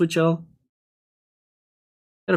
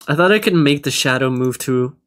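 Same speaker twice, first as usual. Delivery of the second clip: usual speaking voice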